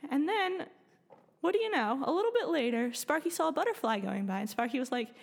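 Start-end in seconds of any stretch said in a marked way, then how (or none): none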